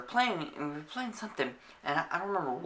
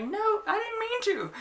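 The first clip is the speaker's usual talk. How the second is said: high, drawn-out mimicking whine; mock girl's excuse. Girl excusing the punch to the teacher, mocking high whine. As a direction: imitate a girl protesting innocence, hammed-up.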